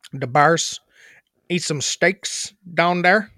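thick mock Chicago accent; hammed-up Midwestern Chicago talk. Shown as a demonstration. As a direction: play a Bears fan from Chicago.

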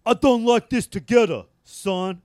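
loud, high, hammed-up Italian accent; bad Italian accent. Imitating an actor scolding a singer, bad Italian accent. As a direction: do a poor Italian-American tough guy. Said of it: "can't do a good Italian accent".

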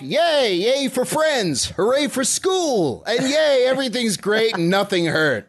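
high, drawn-out happy cheer; mock cheering. Host voicing friends running off happily, mock glee. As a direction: cheer like a carefree kid.

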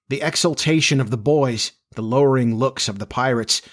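plain reading voice

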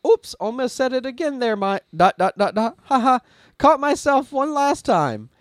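high, loud imitation of a flustered chatbot; over-apologetic AI. AI apologizes to its user for nearly slipping again, mock flustered. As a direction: gush a mock apology, catching yourself.

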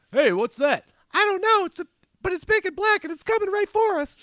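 put-on high, old-school dub dialogue; cheesy seventies dub, overacted; vintage cartoon voice. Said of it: "from the Speed Racer".

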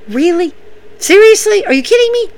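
high, incredulous mock squeal; mock outraged Shayna. Shayna refusing the apology, high incredulous squeal. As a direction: pitch it way up in disbelief. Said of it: "Shayna's look".

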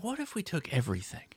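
conspiratorial whisper; whispered scheme. Retold or imitated. Mocking footy's inventors, in a whispered scheming voice. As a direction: whisper it like a sly plotter.

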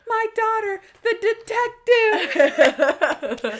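high, drawn-out imitation of a mom; proud mom. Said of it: "his mom's like".

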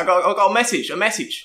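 high, loud cockney urchin call; a street kid hailing the line bull; cheeky street kid. Acted. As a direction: shout up at him like a grubby street kid.

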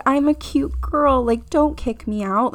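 lightly put-on cute girl charm to talk past the frat brother. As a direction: lift the pitch and play the harmless little visitor. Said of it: "I did, like, a whole".

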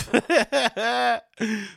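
high staccato cackle; real long cackle; laughing. Real, not acted. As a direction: burst out laughing at the jab. Laughs at the tweet.

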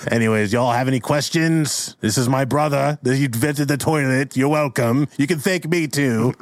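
put-on resentful old brother claiming credit; bitter old Pork. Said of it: "he's old and bitter".

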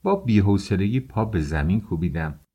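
ordinary delivery, nothing marked

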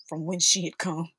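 ordinary delivery

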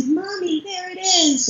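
high-pitched imitation of an amazed small child; little boy's voice. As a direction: call to mom like a little kid. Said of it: "to hear his little voice say".

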